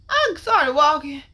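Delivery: ordinary talk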